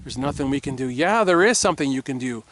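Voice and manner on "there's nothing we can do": mocking, hushed, defeated whisper. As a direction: whisper it like a beaten sheep giving up. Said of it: "They're trying to get us to go".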